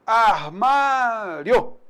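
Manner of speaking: Armário drawn out very slowly; slow demo word. Shown as a demonstration. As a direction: let the rio glide grow slowly. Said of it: "semivogal mais vogal".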